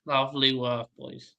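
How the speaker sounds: normal talking voice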